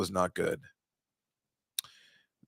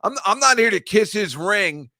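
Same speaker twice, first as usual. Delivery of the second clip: higher, louder brash coach bravado; defiant Rex Ryan; 8 semitones higher, 6 dB louder, slower than usual. Retold or imitated. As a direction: bark it out like a cocky coach. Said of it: "he was just like".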